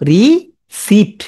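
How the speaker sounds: রিসিপ্ট split slowly as "ree ... sipt"; spelling pronunciation. Shown as a demonstration. Teacher pointing out a letter, slow split রিসিপ্ট. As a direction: break রিসিপ্ট in two and sound the p. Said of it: "এটা আই আছে কিন্তু".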